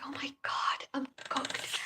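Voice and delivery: whispered, re-enacted inner panic; hushed dread. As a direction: say it under your breath, as if caught out. Dreading being singled out by professor.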